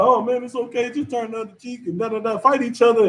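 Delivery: high, breezy mock preacher; mocking Creflo Dollar. Preacher mocking a televangelist's easy advice, high and breezy. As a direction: brush it off lightly, mocking. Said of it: "Somebody like Creflo Dollar".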